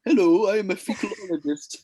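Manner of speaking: mock expert intro.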